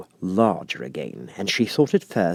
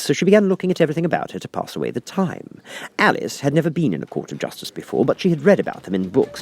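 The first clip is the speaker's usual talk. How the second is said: ordinary delivery, nothing marked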